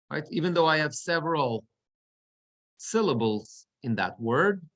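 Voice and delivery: normal talking voice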